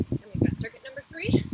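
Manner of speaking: big loud coach call, pitched high; barking gym coach. Real, not acted. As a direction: shout it out to the class. Coach announcing next circuit.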